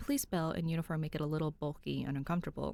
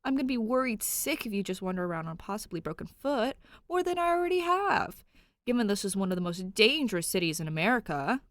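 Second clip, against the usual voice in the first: urgent, pleading voice; worried plea; 4 semitones higher, 5 dB louder than usual. Acted. Cop pleading with hurt Danny, worried. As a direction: press her out of worry.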